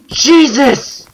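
high, startled outburst with a gasp; real fright. Real, not acted. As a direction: yelp in shock, pitch shooting up. Startled by figures in the trees.

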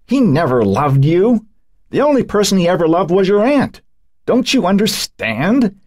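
rising into a bitter accusation; old woman's voice rising. A narrator's reading. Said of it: "Emily's voice rising".

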